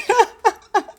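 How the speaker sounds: high giddy laugh of joy; real happy laugh; excited laugh. Real, not acted. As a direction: laugh out the happiness. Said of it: "so excited".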